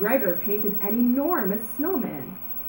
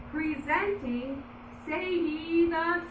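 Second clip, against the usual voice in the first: slow, high grand announcement; slow high showman's announcement; announcing yell; 7 semitones higher, 6 dB quieter, slower than usual. Acted. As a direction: present her like a showman. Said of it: "yelled Martin".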